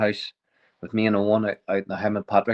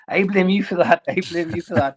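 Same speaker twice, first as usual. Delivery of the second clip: pin the blame on him, mock-furious; father grabbing the referee by the throat, accusing him; angry accusation; 9 semitones higher than usual.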